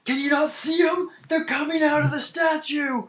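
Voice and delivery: high-pitched, frightened miner; panicked yelling. Acted. Terrified miner to adventurer who sees nothing, high panicked cry. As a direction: cry out in terror at unseen attackers.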